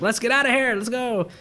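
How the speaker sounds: high-pitched mock panic; mock scared. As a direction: imitate a frightened moviegoer urging escape. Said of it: "he's, like, the one that's scared shitless".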